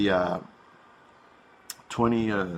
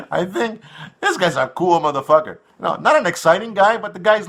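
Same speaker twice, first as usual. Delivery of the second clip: higher, laughing through the words; 6 semitones higher, 3 dB louder than usual. Real, not acted. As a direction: chuckle while saying it. Laughing while talking.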